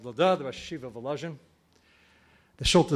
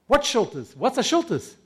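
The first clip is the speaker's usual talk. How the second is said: baffled questions; 7 semitones higher, 3 dB louder than usual. Retold or imitated. Bewildered reply to angel.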